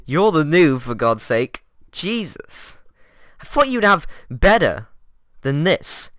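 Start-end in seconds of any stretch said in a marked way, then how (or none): none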